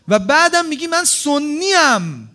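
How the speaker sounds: loud, slow and stressed, pressing each word; indignant emphasis. Acted. Preacher challenging a listener who claims to be Sunni, loud and slow. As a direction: throw the claim back at them, slowly.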